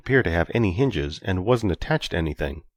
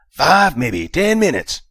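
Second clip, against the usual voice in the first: high, loud, scratchy intercom voice; scratchy speaker voice; 9 semitones higher, 7 dB louder, slower than usual. Acted. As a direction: crackly voice over a speaker. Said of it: "with a scratchy voice".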